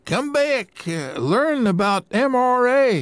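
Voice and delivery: a high, loud call across the lobby. Acted. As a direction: call them back from the booth. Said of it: "he called".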